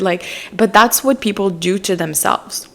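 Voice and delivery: ordinary talk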